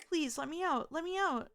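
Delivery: rising panicked plea; desperate begging. Acted. Trapped character begging, pitch climbing.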